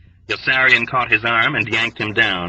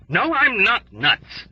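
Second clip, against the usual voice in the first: furious roar; 11 semitones higher, slower than usual. A narrator's reading. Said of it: "Dobbs roared furiously".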